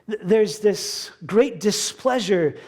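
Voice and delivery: ordinary talk, nothing marked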